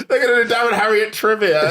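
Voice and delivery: high, laughing delivery; real laughter. Real, not acted. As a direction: crack up while riffing on your own joke. Cracking up at own joke.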